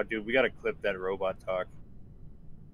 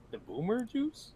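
high, slow disbelieving question; incredulous high question; 11 semitones higher, 5 dB quieter than usual. Real, not acted.

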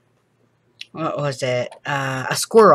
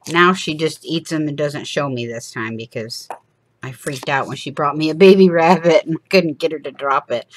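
usual speaking voice

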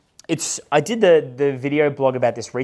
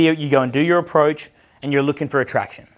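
normal talking voice

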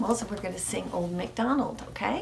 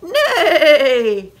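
loud, high, wavering whinny; horse neigh; 7 semitones higher, 12 dB louder than usual. A narrator's reading. Horse helping wake the farmer, neighing. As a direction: let out a falling neigh like a horse. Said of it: "When the horse went".

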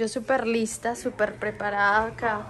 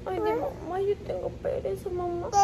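much higher, mock-crying little child complaining; whiny baby voice; 6 semitones higher, 4 dB quieter than usual. Mother speaking for her son, mock-crying whiny baby voice. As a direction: play the baby whimpering that he can't be bothered.

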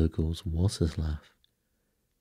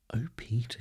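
higher, mildly excited cry; cried out; 6 semitones higher than usual. A narrator's reading. Wendy, thrilled by the fairy, cries to Peter, mildly excited. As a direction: read it as an excited girl's exclamation. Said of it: "she cried".

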